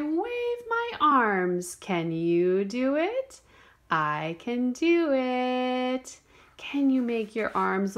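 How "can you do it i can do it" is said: slow. Pretend. Monkey line, slow and louder.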